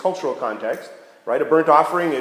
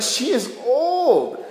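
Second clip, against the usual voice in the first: slow emphatic; 8 semitones higher, much slower than usual. Acted. Stressing how old Sarai is.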